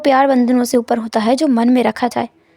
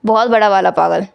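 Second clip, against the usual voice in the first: a lightly lower, cool husband's voice; Wang Ji's calm voice; 5 semitones lower than usual. A narrator's reading. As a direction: say it low and unbothered. (हल्की धीमी, ठंडी आवाज़ में पति)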